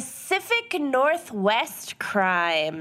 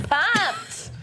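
squeaky excited pumped; 11 semitones higher than usual. Pretend. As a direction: say pumped high and rising, bright. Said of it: "I love it".

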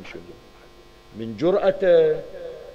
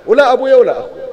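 loud, much higher and fast; defiant outburst; 11 semitones higher, 9 dB louder, faster than usual. Acted. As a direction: fling it out in defiance. Abbas boasts defiance of curse. (تحدٍّ بصوت عالٍ وسريع)

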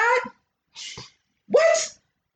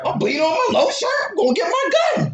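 acting out an outraged fighter, higher at the end; mock outrage; 5 semitones higher than usual. Joking about someone bloodied in a fight, mock outrage. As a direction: play up a worked-up threat, rising in pitch. Acts out person hurt in fight.

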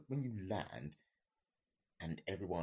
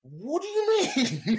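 high, loud, slow laughing What do you mean?; laughing disbelief; 15 dB louder, slower than usual. Retold or imitated. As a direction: laugh out the shock.

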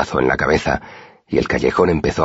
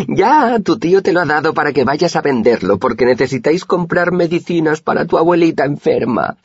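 high, loud mocking sneer; sarcastic mockery; 3 dB louder than usual. A narrator's reading. The thug mocking the narrator's excuse, high sarcastic sneer. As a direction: ridicule his story with fake pity.

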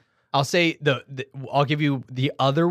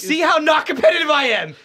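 much higher, louder teasing brag; mock boasting; 7 dB louder than usual. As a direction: gloat playfully. Teasing brag about not competing.

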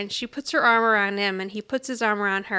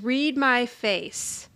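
slow, deliberate; much slower than usual. Retold or imitated. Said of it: "he starts shaking it back and forth ridiculously".

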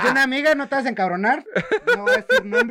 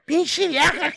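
mock kids' shout; 9 semitones higher, much slower than usual. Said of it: "le gritan".

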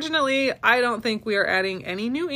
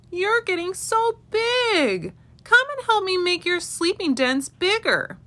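mama rabbit voice; 11 semitones higher than usual. A narrator's reading. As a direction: coax the little ones like a kind mama rabbit. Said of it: "Mama said".

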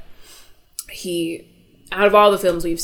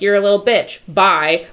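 louder, contemptuous insult then curt goodbye; nasty dismissal; 3 semitones higher, 8 dB louder than usual. Retold or imitated. Confused father turning on his nurse, loud contempt. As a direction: spit it out and slam the door.

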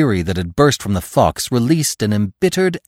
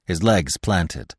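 ordinary delivery, nothing marked

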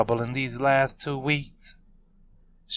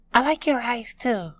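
Kiara voice; 13 semitones higher than usual. A narrator's reading. Kiara replies shyly.

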